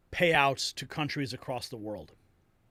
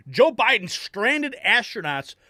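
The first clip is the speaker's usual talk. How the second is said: indignant rise; 7 semitones higher, 7 dB louder than usual. Real, not acted. Outraged punchline.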